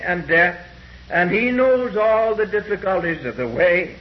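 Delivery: ordinary delivery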